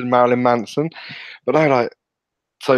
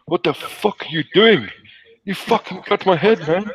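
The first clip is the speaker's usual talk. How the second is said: raised, indignant outburst, imitated; angry South African man; 7 semitones higher than usual. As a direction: play the furious man storming upstairs. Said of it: "a fucking angry South African man".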